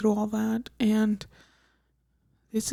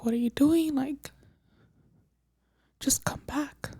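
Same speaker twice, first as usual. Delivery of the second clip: soft, higher-pitched pleading, as remembered; retold plea; 4 semitones higher, 5 dB quieter than usual. Wife retelling her texts to husband who left, softly pleading. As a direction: quietly imitate begging someone to return.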